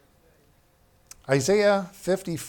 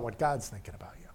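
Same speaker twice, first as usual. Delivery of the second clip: whisper; 4 semitones lower, 7 dB quieter, faster than usual. Real, not acted. Pastor drops to a whisper.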